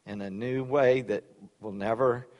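soft, low prayer voice. Real, not acted. Pastor praying over the congregation, soft and reverent. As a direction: pray it quietly and reverently. Closing prayer before the sermon.

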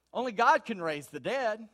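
ordinary talk, nothing marked

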